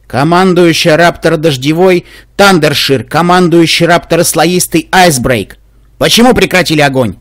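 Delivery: slow, loud, authoritative officer; commanding voice. Acted. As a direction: speak like a commander demanding an answer. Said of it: "Властным голосом спросила командующая кобыла". (властным голосом)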